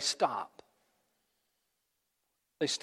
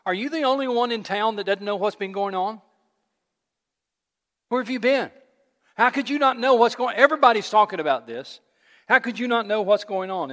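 higher, louder, mock-incredulous; incredulous disbelief; 4 semitones higher, 4 dB louder than usual. Cleopas scolding the stranger who missed the news, mock-incredulous. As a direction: play it as can you believe this guy. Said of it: "In other words".

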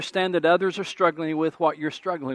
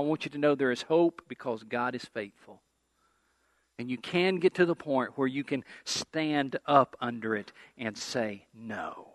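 soft reassurance; 5 semitones lower, 8 dB quieter than usual. Acted. Pastor softens to close point.

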